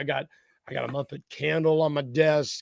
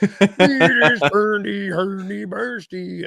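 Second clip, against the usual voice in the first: goofy Muppet voice; 4 semitones higher, 5 dB louder, much slower than usual. Retold or imitated. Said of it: "the Muppets are like".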